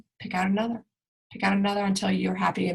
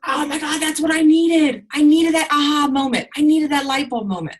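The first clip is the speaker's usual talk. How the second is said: high, loud, thrilled; excited realization; 8 semitones higher, 7 dB louder than usual. Retold or imitated. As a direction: gush like a breakthrough hit. Imagined breakthrough exclamation.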